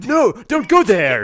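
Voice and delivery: overdone dramatic line. Shown as a demonstration. Reading audiobook lines, hammy dramatic warning. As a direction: play the warning so it sounds silly. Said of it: "I just read a normal line like".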